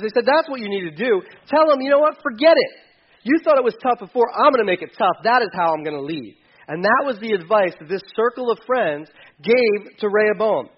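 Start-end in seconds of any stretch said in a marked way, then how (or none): 0.3-6.4 higher, louder egging on; tough advisors. Acted. Young advisors urging the king to be tough, loud. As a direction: hype him up to be harsh.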